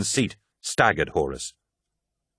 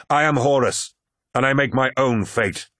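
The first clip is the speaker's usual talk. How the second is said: higher, emphatic self-proclamation; defiant; 4 semitones higher than usual. A narrator's reading. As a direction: declare your independence with force. Horus defies both, proclaiming himself.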